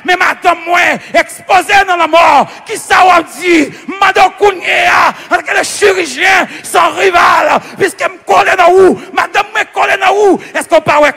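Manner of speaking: loud, insistent preacher's appeal; full-force pleading prayer. Retold or imitated. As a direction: plead the promises back to God forcefully.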